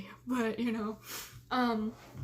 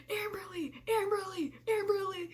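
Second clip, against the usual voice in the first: calling to wake; 5 semitones higher than usual. Retold or imitated. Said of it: "trying to wake me up".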